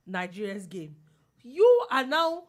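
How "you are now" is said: mock outrage. Real, not acted.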